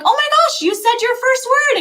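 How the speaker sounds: high, loud gushing delight; excited parent. Retold or imitated. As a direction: gush over the baby's first word. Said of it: "And you're like".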